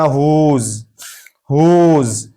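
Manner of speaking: हुज said as "hooz", starting on H; silent W. Shown as a demonstration. Teacher models whose for learners, हुज with silent W. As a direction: say हुज with no W sound. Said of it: "इसको डब्ल्यू को नहीं पढ़ेंगे".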